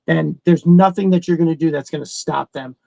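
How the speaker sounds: usual speaking voice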